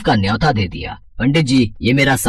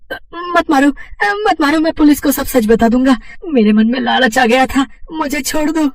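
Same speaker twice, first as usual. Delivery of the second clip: high, frantic pleading; terrified begging; 12 semitones higher than usual. Acted. Thief begging the invisible Hanuman to stop, terrified high pleading. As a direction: beg for mercy in a cracked high voice.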